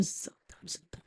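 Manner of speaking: barely audible whispered praise; mock inaudible prayer. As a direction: breathe the words without voice. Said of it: "ప్రార్థన వినపడదు".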